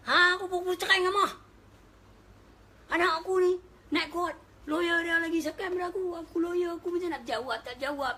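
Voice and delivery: high, loud, worked-up complaint; loud agitated retelling. Retold or imitated. Divorced man complaining to the preacher about court, loud and high. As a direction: belt out the grievance.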